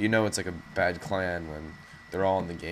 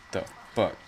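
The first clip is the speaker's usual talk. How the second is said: ordinary delivery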